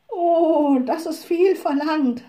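high, loud, reluctant horse; worried objection. A narrator's reading. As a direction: protest that too much is asked. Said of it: "wandten die Pferde ein". (besorgter Einwand mit hoher Stimme)

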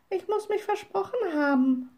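high-pitched little witch; young witch character, pitched well up. A narrator's reading. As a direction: excuse yourself in a small, high voice. Little witch's line in story.

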